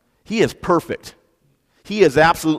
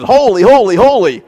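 each holy louder and higher; all caps emphasis; 7 semitones higher, 9 dB louder than usual. Shown as a demonstration. Preacher showing emphasis by repetition, loud and high. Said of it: "the caps part of the text".

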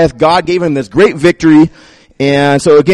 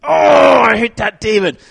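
petulant grumble; 7 semitones higher than usual. Retold or imitated. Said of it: "he just stews and is angry".

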